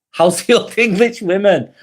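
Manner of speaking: amused, chuckling it out; laughing. Real, not acted. Streamer repeats a viewer's cheeky comment, laughing. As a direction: repeat it through a real laugh.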